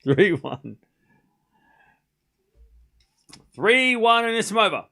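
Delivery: brisk live tennis commentary, lifting on points; play-by-play call. Acted. Streamer calling Wong against Anisimova, live play-by-play. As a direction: call each rally as it happens.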